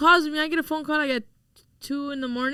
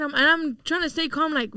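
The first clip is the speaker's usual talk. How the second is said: normal talking voice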